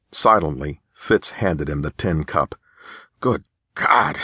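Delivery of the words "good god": choking on the drink; choked. A narrator's reading. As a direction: gag out the words. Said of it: "James choked".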